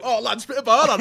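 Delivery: put-on high, loud drunken mate shouting; Whitby egging him on. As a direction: egg him on like a tipsy mate at a party. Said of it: "he'd go".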